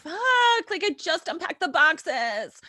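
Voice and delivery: high, loud comic outburst; mock exasperation. Wife told they must leave town, exasperated mock wail. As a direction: whine it loudly in hammed-up frustration.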